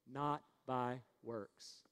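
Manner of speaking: slow, low and soft, word by word; leading a recitation. Shown as a demonstration. Preacher leading the congregation to repeat, slow and subdued. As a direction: spell it out slowly for repeating. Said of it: "Say it with me".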